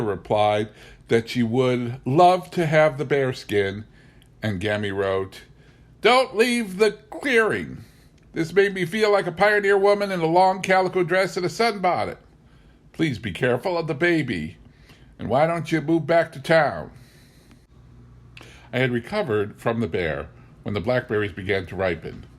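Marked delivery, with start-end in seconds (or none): 6.0-16.9 fretting grandmother. Acted. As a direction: plead with her to be careful. Gammy's anxious letter.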